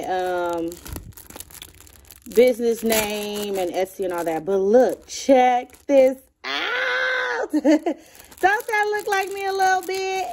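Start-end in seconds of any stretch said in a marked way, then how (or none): 5.1-10.3 high, thrilled and laughing; delighted laughing squeal; excited squeal. Real, not acted. Excited over gift stickers.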